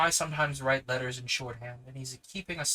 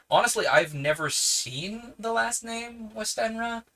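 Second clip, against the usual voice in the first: excited; 9 semitones higher, 5 dB louder than usual. Real, not acted. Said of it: "rising exclaim".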